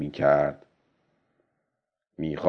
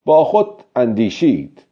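usual speaking voice

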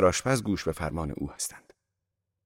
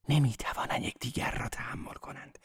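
soft, breath-only whisper; whispered confidence; 5 semitones higher, 8 dB quieter than usual. A narrator's reading. Catherine gossiping about the married couples, whispering. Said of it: "به نجوا در گوشم گفت".

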